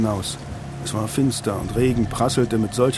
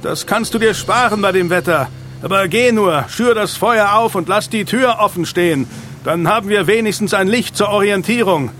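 urgent high, loud order; roared over storm; 8 semitones higher, 5 dB louder than usual. A narrator's reading. Leader orders everyone to higher ground, roaring. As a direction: shout orders against the storm.

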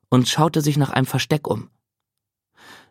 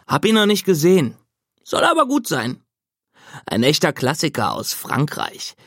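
easygoing big brother; 2 semitones higher than usual. Acted. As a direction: toss it off kindly on the way out. Brother raving about a film.

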